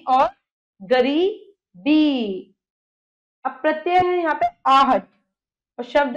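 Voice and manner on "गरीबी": drawn out slowly. Shown as a demonstration. Teacher dictating words to class.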